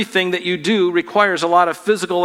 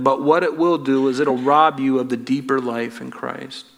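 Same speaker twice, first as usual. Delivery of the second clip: ordinary delivery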